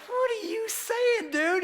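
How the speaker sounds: very high, slow disbelieving question; mock incredulity. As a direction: squeak it out in exaggerated disbelief. Retold disbelief at the kicker's claim.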